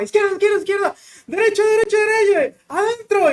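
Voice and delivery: high, loud, fast shouted orders; shouted commands. Retold or imitated. Instructor yelling over the screaming girls, high loud shouts. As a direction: bark the paddling commands over the noise. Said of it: "el instructor estaba".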